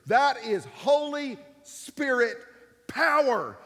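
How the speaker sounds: preaching. Acted. Pastor proclaiming to the congregation, full force.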